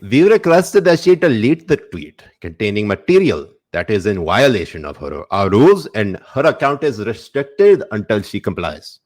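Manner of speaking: put-on South Indian accent, read with gusto.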